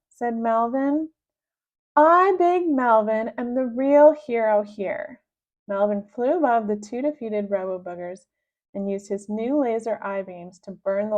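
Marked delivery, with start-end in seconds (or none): 2.0-5.2 slow, loud, pompous declaration; grand proclamation. Acted. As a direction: proclaim it like a self-made hero. Melvin proclaims himself hero.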